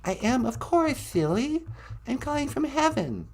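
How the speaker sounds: high, unhurried falsetto impersonation. Acted. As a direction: play the pop star in a high voice. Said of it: "It's me, [name]".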